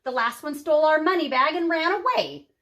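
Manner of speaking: high, hammed-up giantess calling for help; giantess crying out. A narrator's reading. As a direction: squeal it out as a flustered giantess. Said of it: "she grabbed Kate and cried".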